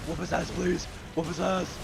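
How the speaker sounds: loud yell; shouting. Real, not acted. Yelling during a fight.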